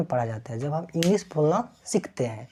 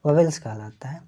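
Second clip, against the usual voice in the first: whispered answer. Shown as a demonstration. Teacher whispers the answer to the class. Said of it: "क्या कहलाता है?".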